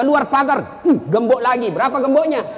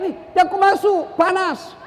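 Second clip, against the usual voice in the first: high-pitched acted alarm cry; yelled warning; 7 semitones higher than usual. Rich man warning a stranger off the lift, yelling. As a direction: cry out a mock-urgent warning. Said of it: "dia teriak".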